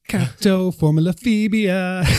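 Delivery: high, slow mock-epic robot name; drawn-out, grandiose toy-robot voice; Transformers name. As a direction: announce it like a cartoon robot hero. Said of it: "They sound like Transformers".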